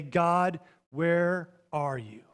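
soft, very slow question to God; simple prayer. Acted. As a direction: ask God softly, word by word, with space between. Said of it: "And we simply say".

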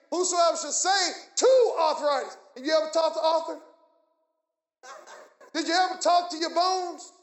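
rhythmic, driving sermon questions building to a shout; fiery pulpit delivery; forceful preaching. Real, not acted. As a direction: preach it hard, punching the key words.

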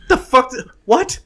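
high, loud startled yelp; squeaky shocked what-the-heck; stunned disbelief. Retold or imitated. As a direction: jolt up in sheer disbelief. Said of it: "And it's like".

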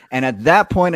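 ordinary delivery

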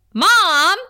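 loud, high mock whine of Mom; shouted Mom. Buffy and Harriet calling out to Joyce together, loud whine. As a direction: yell it like a fed-up teen. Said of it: "both her and Harriet say".

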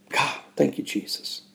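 real emotion, voice dropping almost to nothing; hushed, moved thanks under the breath; overcome with emotion. Real, not acted. Podcaster thanking God mid-testimony, moved and hushed. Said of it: "It can be emotional sometimes".

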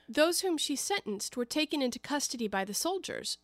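usual speaking voice

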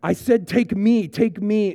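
loud, high insistent yell; retold yell. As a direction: yell it after the man being dragged off. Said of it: "Hawkeye is yelling at him".

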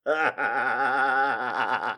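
bursting out laughing. Real, not acted. Reader cracks up.

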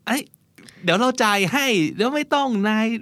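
playfully grab the check from a friend; mock fight over the bill. Host to friend, fighting to pay, eager mock insistence. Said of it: "ทำเป็นแย่งเช็คกันนิดนึง".

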